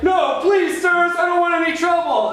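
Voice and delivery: high, loud mock begging; pleading victim. Roommate pleading with attackers, high loud mock plea. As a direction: beg like a frightened man. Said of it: "And he's like".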